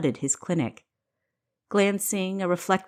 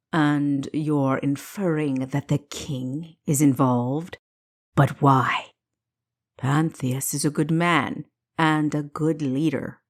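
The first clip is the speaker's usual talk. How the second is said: lightly voiced man, slow then a sharp why; doubting doctor; 2 semitones lower than usual. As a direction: question her disbelievingly. Green doubts the stranger's claim.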